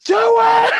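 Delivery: piercing, very high imitated shriek; frantic shout. Wounded driver about to drench his arm in liquor, frantic shout. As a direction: scream the command at full tilt. Character psyching himself up, louder.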